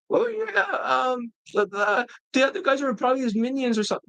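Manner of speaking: mock high-pitched student, falsetto easing down; dismissive student. Student dismissing the other guy, high mocking voice. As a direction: imitate him brushing it off in a high voice. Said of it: "He'd be like".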